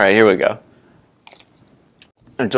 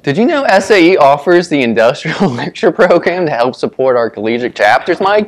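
loud ad read; 2 semitones higher, 4 dB louder than usual. Real, not acted. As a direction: push through the ad excitedly. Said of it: "read whatever we posted".

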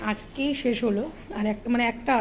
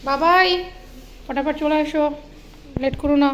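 loud call across the house, higher; 4 semitones higher, 3 dB louder than usual. Real, not acted. Mother calling her son to come home quickly, loud call.

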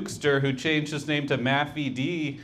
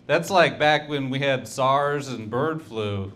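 usual speaking voice

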